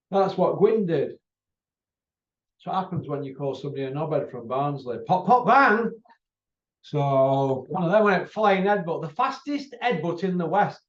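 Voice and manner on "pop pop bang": mock punch sounds. Headbutt sound effect.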